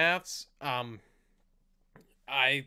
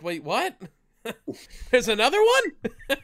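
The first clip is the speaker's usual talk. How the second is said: mock disbelief rising high and loud, breaking into laughs; higher, louder on the punchline, laughing; retold surprise; 7 semitones higher, 4 dB louder than usual. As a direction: act out a startled double-take. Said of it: "I was like".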